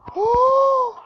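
very high, loud sharp gasp; startled gasp; shocked shrill gasp. Real, not acted. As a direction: gasp as if jumped at. Fright in game.

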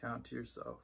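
soft, unhurried meditation voice with long pauses; hushed, even cues for noticing breath and body; guided meditation. Acted. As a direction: guide a quiet body scan, leaving space after each phrase.

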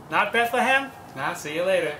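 ordinary talk

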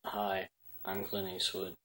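ordinary talk

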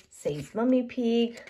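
plain reading voice

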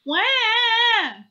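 put-on very high, loud baby bawl; acted little owl wail; bursting into mock tears. A narrator's reading. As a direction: burst out with a childish wah. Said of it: "Baby Owl burst into tears".

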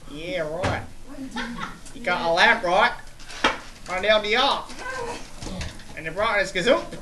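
teasing imitation of a student's Aussie accent; lightly put-on Australian accent, rephrasing the answer; mock Aussie accent. Teacher mimics student's answer in a mild mock Aussie accent. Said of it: "in your crazy Aussie accent".

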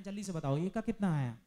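loud, pressing classroom demand; loud urging. Real, not acted. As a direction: push the class for an answer at volume. Teacher presses class to answer.

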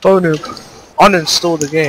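high sing-song mock sympathy; mocking pity. Real, not acted. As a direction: tease the loser in a high voice. Mocking defeated enemy.

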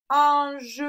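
slow "Ange" in two beats, an-jeu; final syllable sounded. Shown as a demonstration. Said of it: "sur la seconde". (« Ange » en deux syllabes, e final prononcé)

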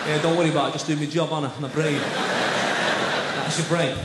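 low, gruff doorman voice; bouncer. Pretend. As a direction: talk like a nightclub bouncer. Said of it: "Like, talking like a bouncer".

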